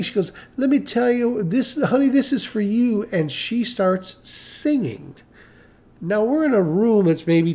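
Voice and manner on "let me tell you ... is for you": higher-pitched gushing, as imitated praise; lightly acted gushing woman; effusive customer. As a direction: play her earnest praise, pitched up. Retelling customer announcing a song.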